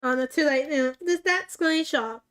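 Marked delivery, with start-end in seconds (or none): none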